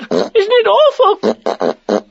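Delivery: very high, slow, squeaky dread; frightened Piglet. A narrator's reading. Piglet clinging to Christopher Robin, frightened. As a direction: squeak it out in horror. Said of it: "said Piglet".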